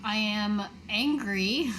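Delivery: ordinary talk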